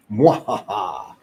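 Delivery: slow drawn-out villain cackle; evil laugh. Pretend. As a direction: cackle slowly like a mad scientist. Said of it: "The laugh of evil".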